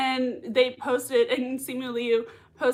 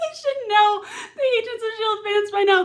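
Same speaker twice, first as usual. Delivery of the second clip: laughing; 10 semitones higher, 5 dB louder than usual. Real, not acted. Laughing at fans' antics.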